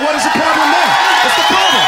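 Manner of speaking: mock-defiant shout; loud challenge. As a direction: push back hard at the crowd. Comic demands loudly.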